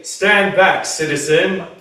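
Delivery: booming superhero command; bold heroic voice. Shown as a demonstration. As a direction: proclaim it like a superhero. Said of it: "Can you say".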